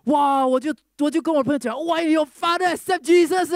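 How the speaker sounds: high, loud, fast burst of joy; overjoyed. Real, not acted. Joyful news to friend.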